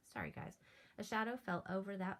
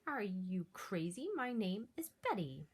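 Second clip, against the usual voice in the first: louder, indignant monster voice; offended monster; 5 dB louder than usual. Acted. Monster Betty snapping at George, louder and indignant. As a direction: bristle at being called a Yeti. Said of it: "with a furious gaze".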